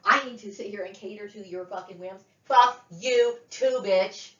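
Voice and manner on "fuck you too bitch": slow angry curse. Real, not acted. Furious rant at relatives.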